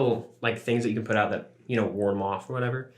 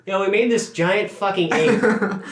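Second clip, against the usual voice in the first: laughing; 9 semitones higher, 6 dB louder, much slower than usual. Retold or imitated. Scientists quoted goofing off.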